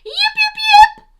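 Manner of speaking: very high, loud little yips; a little fox calling out across the hill toward the music; fox yipping call. Acted. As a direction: call out like a small fox into the night. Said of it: "and she called".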